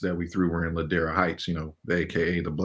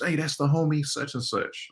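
put-on excited old neighbor; 8 semitones higher than usual. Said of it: "I remember we knew some of those people".

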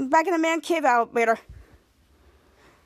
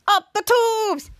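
high, drawn-out command; mock catchphrase call; 7 semitones higher, 3 dB louder, much slower than usual. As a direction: imitate a hero calling out a launch order.

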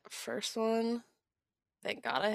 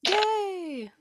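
high, loud gleeful cheer; high whoop sliding down; 11 semitones higher, 5 dB louder than usual. Real, not acted. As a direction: let out a drawn-out yay. Cheer as enemy fails save.

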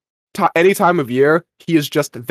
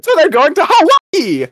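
mock excitement; 13 semitones higher, 5 dB louder than usual. Excited kid in retold scene.

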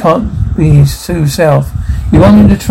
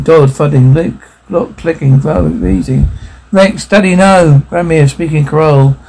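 ordinary delivery

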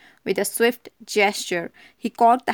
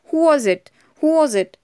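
louder and higher, a panicked cry; frightened cry; 6 semitones higher, 4 dB louder than usual. A narrator's reading. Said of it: "Vera cried".

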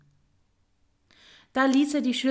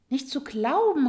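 higher, mildly exclaimed disbelief; a mouse's astonished cry, lightly voiced; incredulous exclamation; 5 semitones higher, 4 dB louder than usual. A narrator's reading. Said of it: "rief die Maus".

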